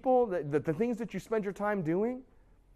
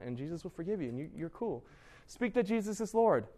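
ordinary delivery

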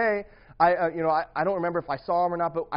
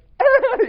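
loud high burst of laughter; 13 dB louder than usual. Real, not acted. Laughing at hitting a kid, loud high laugh. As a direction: cracks up at his own punch. Big laugh mid-story.

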